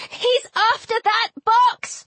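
high-pitched urgent call; urgent warning. A narrator's reading. Heroine warning friends in battle, urgent and high. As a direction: cry out a warning, pitched well up.

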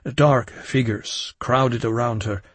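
ordinary delivery, nothing marked

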